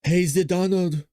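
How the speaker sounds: put-on slow, fumbled stab at a Saudi accent; attempted Saudi accent. Host voicing the Saudi prince, tentative Saudi accent. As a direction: try out a rough Saudi accent. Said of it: "how would you do a Saudi Arabia accent".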